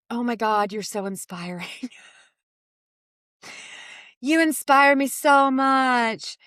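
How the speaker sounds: slow, gushing admirer, not mocking; gushing fan voice. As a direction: gush with sweet awe. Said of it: "I'm not doing the voice because I'm making fun".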